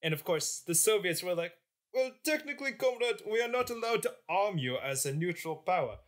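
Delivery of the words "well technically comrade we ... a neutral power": hammed-up Russian accent, pitched higher; mock Russian accent; mock Soviet accent. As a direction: play a Soviet official with a thick fake Russian accent. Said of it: "What sort of accent is this".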